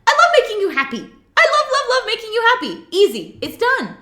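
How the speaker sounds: high, loud, laughing delight; overjoyed to please his wife; eager husband. Retold or imitated. Husband gladly agreeing to do the dishes, high and giddy.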